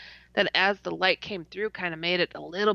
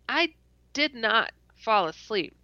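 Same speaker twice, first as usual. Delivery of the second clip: normal talking voice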